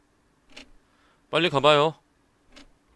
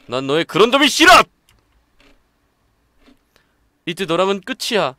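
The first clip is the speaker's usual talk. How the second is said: angry outburst; 5 semitones higher, 10 dB louder than usual. Acted. Character breaks off friendship.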